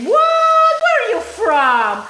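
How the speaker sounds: sudden high, loud bewildered "What?"; puzzled Italian local. Retold or imitated. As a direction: mimic a baffled local catching a strange word. Italians puzzled by tourist words.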